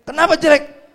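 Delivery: loud, high-pitched demand; mock-offended parent, voice jumping up; indignant question. Mother challenging her child's remark, loud mock indignation.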